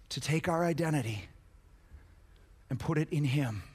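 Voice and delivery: low, soft, measured delivery; hushed, reverent sermon voice; solemn preaching. Real, not acted. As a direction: bring it down low and let pauses hang.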